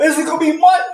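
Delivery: high, building to a shout; hyped commentator. Retold or imitated. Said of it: "They'll be like".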